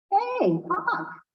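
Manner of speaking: high bright call across; friendly call. Acted. Raven calling out to Hawk, high call. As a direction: lift the voice to hail him. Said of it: "he says".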